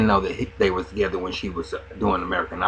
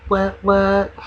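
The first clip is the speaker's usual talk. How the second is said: mock excited squeal; 9 semitones higher, 3 dB louder than usual. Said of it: "pussy go".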